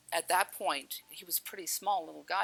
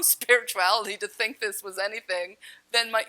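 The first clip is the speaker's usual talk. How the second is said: plain reading voice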